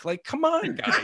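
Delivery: very high, exasperated mock plea; pissed-off uncle. Uncle begs the guys at his cottage, mock exasperated. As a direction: plead like a fed-up uncle, hammed-up. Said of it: "pissed off".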